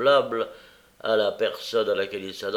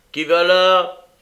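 loud, raised gruff challenge; big rough voice, pitched up and loud; 8 semitones higher, 10 dB louder, slower than usual. A narrator's reading. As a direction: call out sharply to whoever is there. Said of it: "dit une grosse voix".

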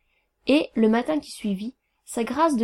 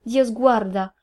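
ordinary delivery, nothing marked

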